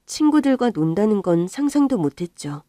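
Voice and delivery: ordinary talk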